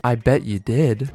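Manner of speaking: slow, low, soft mock-seduction; flirty teacher. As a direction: imitate a suggestive teacher, quiet and drawn out. Teacher voiced flirting again.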